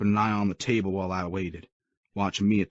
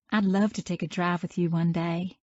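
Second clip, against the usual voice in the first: put-on much higher, sweet girl's voice; sweet young woman; 11 semitones higher than usual.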